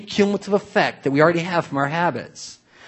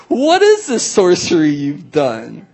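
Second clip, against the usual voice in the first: slow, louder hammed-up astonishment; mock amazement; 5 dB louder, slower than usual. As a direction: act floored by the trick, slowly. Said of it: "I'm like".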